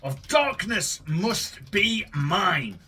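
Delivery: normal talking voice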